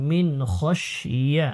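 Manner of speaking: normal talking voice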